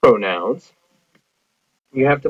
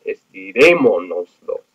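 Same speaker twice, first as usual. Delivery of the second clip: estirémonoslos drawn out, RÉ punched; teacher's exaggerated accent on RÉ in estirémonoslos; stress on the accent; 2 semitones lower, 3 dB louder than usual. Shown as a demonstration. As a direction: slowly show off the stressed "ré" in estirémonoslos. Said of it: "you can hear that accent".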